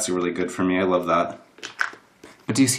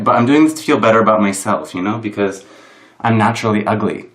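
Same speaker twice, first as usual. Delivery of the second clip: normal talking voice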